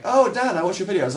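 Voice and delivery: high, loud fan greeting; excited fan. Retold or imitated. A stranger recognising him in a shop queue, excited. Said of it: "some guy was like".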